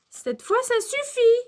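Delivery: high, loud telling-off; maîtresse qui se fâche. A narrator's reading. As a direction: lose patience, rising sharply in pitch. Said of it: "se fâche la maîtresse". (colère de maîtresse, voix qui monte)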